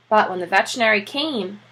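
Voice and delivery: ordinary delivery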